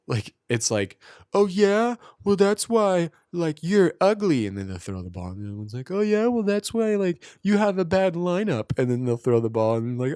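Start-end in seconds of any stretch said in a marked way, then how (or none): 1.3-4.4 put-on high-pitched kid trash talk; kids roasting. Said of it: "they're, like, roasting each other". 5.9-8.8 put-on high-pitched kid trash talk; kids roasting. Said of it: "they're, like, roasting each other".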